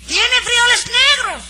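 hammed-up falsetto of a fussy customer; mocking Nananina's voice. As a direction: mimic the customer in a shrill falsetto. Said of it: "yo hablo así con esa voz".

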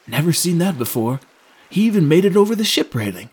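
mildly awed, pitch rising as it goes. A narrator's reading. Sailor amazed at the beast's great leap, awed. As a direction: marvel with growing amazement. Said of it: "remarked the sailor in awe".

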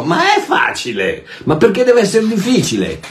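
protesting, pitch climbing high on the question; exasperated rebuttal. Real, not acted. Preacher answers an imagined objector who doubts it is easy, exasperated. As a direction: push back in high-pitched disbelief.

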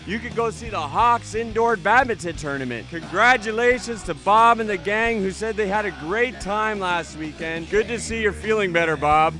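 cheesy drive-time radio host; radio DJ patter. Acted. Radio DJ show intro.

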